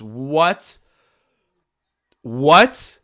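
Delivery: stunned what. Real, not acted. Shocked at a surprise shot.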